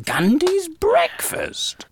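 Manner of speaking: high, slow and baffled; can't believe what she just said; incredulous. Real, not acted. Baffled echo.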